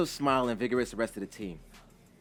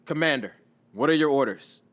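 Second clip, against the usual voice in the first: slightly raised, louder address; dutiful military query; soldier reporting; 3 semitones higher, 7 dB louder than usual. A narrator's reading. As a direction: ask a superior for orders. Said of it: "One of his soldiers".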